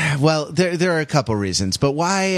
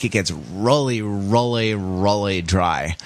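put-on lazy, drawn-out Los Angeles accent; 4 semitones lower than usual. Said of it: "I said that in my Los Angeles accent".

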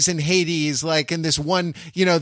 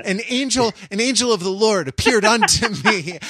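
mock biblical proclamation; 6 semitones higher than usual. Mock biblical quote, laughing.

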